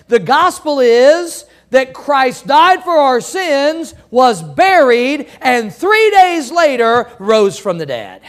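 loud, slow, emphatic preaching. Acted. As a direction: hammer home each phrase. Preaching the gospel.

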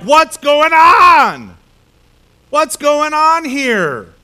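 loud, very slow alarmed cry; alarmed shout. Retold or imitated. Joshua to his fleeing army, loud slow alarmed shout. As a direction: call out in dismay at the rout.

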